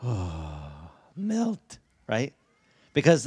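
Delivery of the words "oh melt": melting parent. Retold or imitated. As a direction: swoon like a parent hearing dada. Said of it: "we're like".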